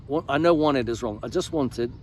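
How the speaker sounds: usual speaking voice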